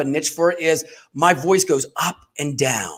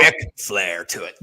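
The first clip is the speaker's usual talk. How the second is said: mock flair voice; 10 semitones lower than usual. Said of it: "add a little bit of flair".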